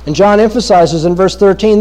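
ordinary talk, nothing marked

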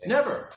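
"Never" punched high and loud; final ringing "Never"; emphatic never. Real, not acted. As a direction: land the single word "Never" hard. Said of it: "you're never gonna win".

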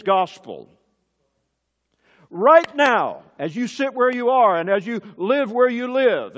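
loud, high, urgent pulpit voice; building to a loud preaching peak; fiery preaching. Acted. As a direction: hammer each clause at the listeners. Preaching at full force.

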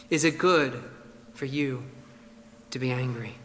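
the preacher asks his hearers softly; soft reflective question. Shown as a demonstration. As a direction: put it gently to the congregation. Question turned on the listener.

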